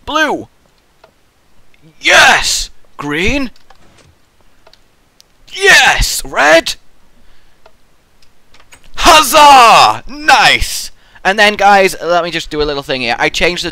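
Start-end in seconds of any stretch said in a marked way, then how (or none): 0.1-6.8 high, loud rising cheers; celebrating each light coming on; excited. Real, not acted. 9.0-10.9 very loud, high cheer; solving the colour puzzle, shouting huzzah; triumphant shout. Real, not acted.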